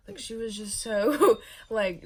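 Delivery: words broken up by a loud laugh; giggling while telling it. Real, not acted. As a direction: laugh through it. Laughing about friend's confident guess.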